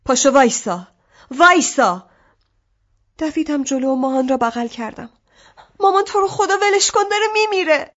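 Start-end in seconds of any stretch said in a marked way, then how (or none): 0.0-2.1 put-on loud desperate yell at the child; louder frantic command, building; screaming. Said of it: "مامان جیغ کشید". 5.8-7.9 put-on much higher terrified child begging; high pitched desperate cry; frantic plea. Girl begs mother to stop.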